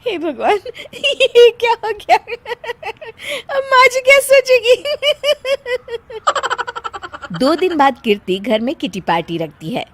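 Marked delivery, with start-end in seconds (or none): 0.0-7.4 high, giggling worry between laughing fits; uncontrollable laughing. Acted. Daughter-in-law wondering what mother-in-law will think, laughing helplessly. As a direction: fret while unable to stop laughing. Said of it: "हंसने वाली दवा खा लेती है".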